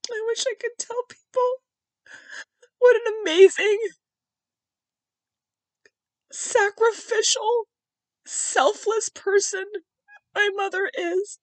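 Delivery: high, strained voice through real tears; crying. Real, not acted. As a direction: choke out the praise slowly while crying. Speaker breaks down praising her mother.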